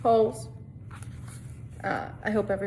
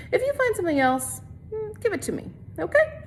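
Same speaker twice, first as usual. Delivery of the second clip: put-on much higher, sly boy's voice; X-Ray voice; 12 semitones higher, 3 dB louder than usual. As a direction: pitch it way up like a scheming kid. X-Ray asks for the find.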